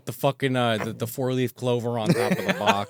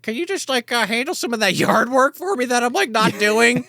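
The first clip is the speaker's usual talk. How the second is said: higher, wheedling favour-asking voice; sheepish Darby; 9 semitones higher than usual. Retold or imitated. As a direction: ask the favour a little too sweetly. Darby asking for yard work.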